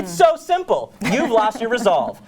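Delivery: high-pitched, acted reproach; exasperated chiding. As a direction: chide him, starting high. Lady Macbeth chides her husband.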